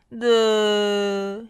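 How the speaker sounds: long loud groan of misery; drawn-out poorly grumble; grumbling mutter. A narrator's reading. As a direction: groan it out, bunged up with a cold. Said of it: "he muttered".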